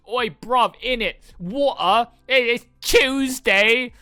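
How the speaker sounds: put-on loud, high-pitched caricature British accent; mock British slang. British gamer parodying internet jokes about Brits, mock British accent. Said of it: "We're now just a joke on the internet".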